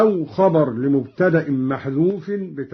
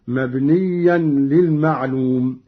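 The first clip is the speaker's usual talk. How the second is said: ordinary delivery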